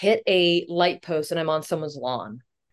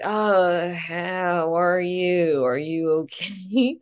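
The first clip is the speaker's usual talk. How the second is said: very slow, drawn-out concern; painfully measured, held-back question; strained restraint; much slower than usual. Retold or imitated. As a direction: imitate a dad forcing himself to stay calm. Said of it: "took him everything in his power".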